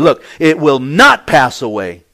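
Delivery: firm, forceful warning that allows no doubt; emphatic. Acted. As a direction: drive each point home forcefully. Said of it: "Jesus was being emphatic here".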